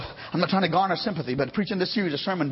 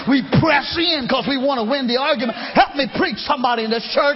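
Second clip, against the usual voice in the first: higher, louder shouted preaching climax; climactic preaching; 7 semitones higher, 6 dB louder than usual. Acted. Preacher urging congregation to press in, loud high shouted climax. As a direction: hit the peak and hold it.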